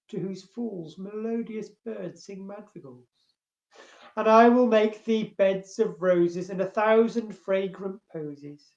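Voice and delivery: measured verse reading with strong line openings; poem recitation. Acted. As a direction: recite it deliberately, lingering on the listed images. Reads a second love poem.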